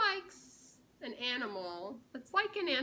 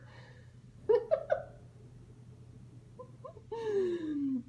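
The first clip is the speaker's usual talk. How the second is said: high laugh; 8 semitones higher than usual. Real, not acted. Laughing at own joke.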